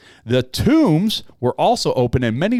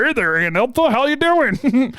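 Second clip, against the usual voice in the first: cheery zombie greeting; 11 semitones higher than usual. Pretend. Dead man greeting people in Jerusalem.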